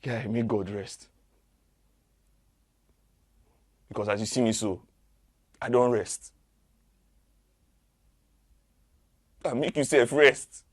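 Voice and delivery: defiant rage swelling to a yell; mounting fury. Acted. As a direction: build the threat to a loud peak. Furious retort building.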